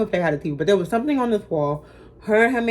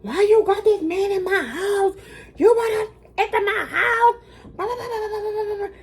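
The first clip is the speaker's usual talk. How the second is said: much higher, squeaky imitation of a mom scolding, trailing off into blah blah; mocking the yelling mom; 13 semitones higher than usual. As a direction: mimic the angry mother as a shrill caricature. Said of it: "was yelling at me".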